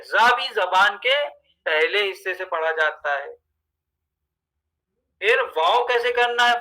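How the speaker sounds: normal talking voice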